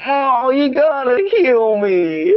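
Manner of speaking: high, slow, whiny wounded soldier. Retold or imitated. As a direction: whine it out like a hurt video game grunt. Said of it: "whiny voices of your, like, your soldiers".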